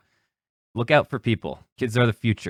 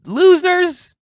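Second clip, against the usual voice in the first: shrill high mock scream of an angry woman; squealing falsetto insult, much higher; 5 dB louder, slower than usual. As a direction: imitate her screeching at them. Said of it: "starts screaming at us".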